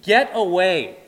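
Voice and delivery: forceful shouted plea; agonized outburst. Acted. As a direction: cry out to drive someone off. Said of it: "He's saying".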